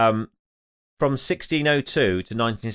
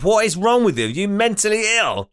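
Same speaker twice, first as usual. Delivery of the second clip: mock outrage; 8 semitones higher, 3 dB louder than usual. Mock outrage at number callers.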